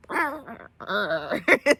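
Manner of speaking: rough animal growl imitating an angry moose; imitated moose noise. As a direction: growl like a charging mother moose. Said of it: "She's like".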